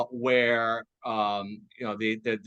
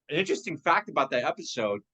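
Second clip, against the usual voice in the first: ordinary talk, nothing marked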